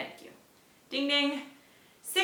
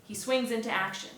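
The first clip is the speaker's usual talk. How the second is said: ordinary delivery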